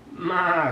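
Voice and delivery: loud high goat bleat. Acted. Goat bleat in picture book.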